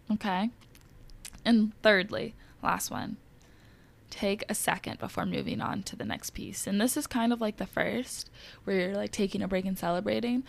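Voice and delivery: usual speaking voice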